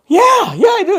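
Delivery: high, loud burst of retold eagerness; eager mock shout. Customer answering Taka about fried chicken, eager mock shout. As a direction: imitate an over-keen customer shouting his answer.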